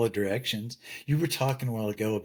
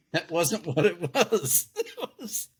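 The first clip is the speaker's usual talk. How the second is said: laughing; 6 semitones higher, slower than usual. Real, not acted. Laughing at his own lesson.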